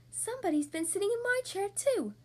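high, indignant mother bear; high-pitched storybook bear voice; Mommy Bear growl. A narrator's reading. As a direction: complain high, like a cross mama bear. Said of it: "growled Mommy Bear".

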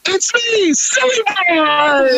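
high, slow, shouted hype-man voice; Silly Boy stage persona. Retold or imitated. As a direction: bellow the silly character's entrance. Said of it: "I walk on stage and I go".

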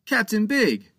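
Captain Joe breaking into the pirate's threat; cutting in. Acted. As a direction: interrupt firmly by name. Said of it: "Captain Joe interrupted".

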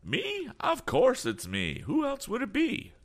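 high-pitched, drawn-out exclamations; flustered eccentric. Acted. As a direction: play a fussy, lost eccentric in a high voice. Damon answers, startled.

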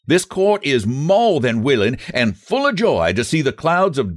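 high, fast self-important justice; pompous judge. Acted. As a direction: lay down the law grandly. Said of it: "he said".

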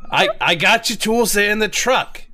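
impatient Brooklyn plumber; Brooklyn accent. Acted. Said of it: "my rough Brooklyn accent".

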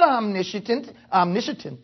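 "omniscient" garbled as om-NISH-uh-tent; mispronounced word. Real, not acted. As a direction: stumble into an extra syllable, "omniscient" as om-NISH-uh-tent. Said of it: "I invent words when I'm preaching".

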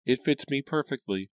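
put-on high little girl voice; girl's voice. A narrator's reading. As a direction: lift the voice up into a young girl.

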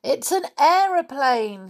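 high, loud cry of alarm; excited shout. A narrator's reading. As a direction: shout out a sighting in the story. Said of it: "shouted Peter".

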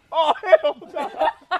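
loud, slow mock outrage; outraged refusal. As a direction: drag it out as an appalled mom. Mother refuses the news, retold.

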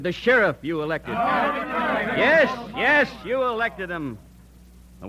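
rally the men to march on the jail; rousing speech. Acted. Leader rousing the vigilance army to march, oratorical.

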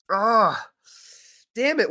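high, loud groan of disgust. Real, not acted.

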